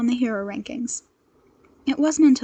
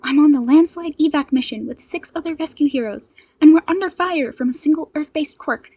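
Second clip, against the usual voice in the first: raised, pressing voice over the radio; urgent hero call; 5 semitones higher, 8 dB louder than usual. Acted. Ochako radioing the agency for backup, urgent.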